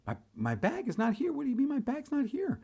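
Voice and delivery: high, incredulous protest; can't believe what you're hearing; disbelief. Retold or imitated. Retold disbelief at missing bag.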